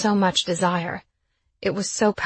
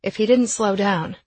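plain reading voice

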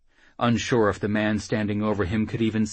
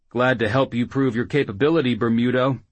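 fast, louder call upward; 5 semitones higher, 7 dB louder, faster than usual. Acted. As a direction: call it up from below. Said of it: "Jackal called up".

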